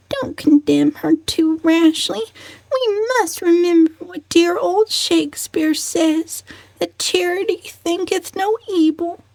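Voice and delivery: put-on calm him like a prim old lady; soothing old cousin. A narrator's reading. Elderly cousin soothing Benjamin, high and gentle. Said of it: "said Cousin Georgiana soothingly".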